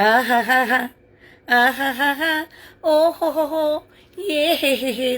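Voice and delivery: sing-song fake wailing; mocking, drawn-out wails rising in pitch; mock crying. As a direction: imitate a crybaby's rhythmic boo-hoo. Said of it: "ദാ ഇതാണ് നമ്മുടെ അഫ്സൽ".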